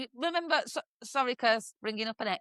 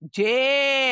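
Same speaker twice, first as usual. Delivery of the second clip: loud, drawn-out northern English call; Georgie; 4 semitones higher, 6 dB louder than usual. Retold or imitated. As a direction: imitate a northern English neighbour hollering. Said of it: "another northerner from next door".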